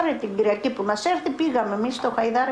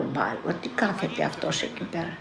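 puzzled murmur; 6 semitones lower, 5 dB quieter than usual. Retold or imitated. Wondering to herself at dawn.

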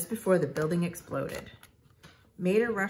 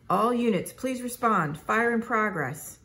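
louder, higher, stretched-out announcement; blaring dispatch voice; 5 semitones higher, 4 dB louder than usual. A narrator's reading. Dispatcher calls all units to a fire, blaring. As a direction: blare it like a voice from a speaker. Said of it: "blared the voice from the speaker".

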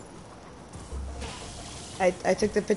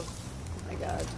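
whispered; 14 dB quieter, slower than usual. Real, not acted. Stunned after game event.

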